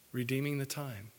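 a hushed, reflective repeat. Shown as a demonstration. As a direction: drop the voice and echo it quietly. Repeats phrase for emphasis.

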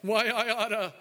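high, brash mock New York accent; Brooklyn tough guy. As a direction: play Peter as a Brooklyn wise guy. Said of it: "And Peter said".

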